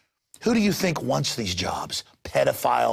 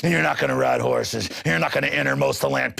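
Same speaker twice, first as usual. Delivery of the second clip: ordinary delivery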